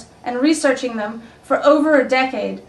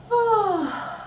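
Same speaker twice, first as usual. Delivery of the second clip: soft demo sigh; 8 semitones higher, 6 dB quieter than usual. Shown as a demonstration.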